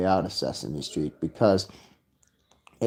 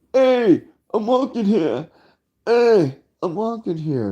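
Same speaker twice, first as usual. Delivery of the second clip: loud high New York "Hey, I'm walking here"; mock New Yorker yelling at a car; New York shout; 11 semitones higher, 4 dB louder than usual. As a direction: yell it like an angry New York pedestrian.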